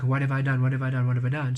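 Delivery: mock panic, asking again and again; freaking out. Reader voicing the panicked querent, freaking out. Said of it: "you're almost freaking out, like".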